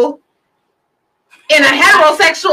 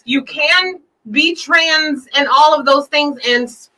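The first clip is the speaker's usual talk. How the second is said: normal talking voice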